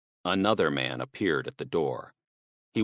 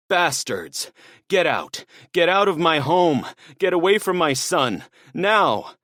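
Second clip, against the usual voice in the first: much higher and louder, raging at them; furious shouting; 10 semitones higher, 6 dB louder than usual. Acted. Father screaming at the intruders in fury. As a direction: roar them out of the house.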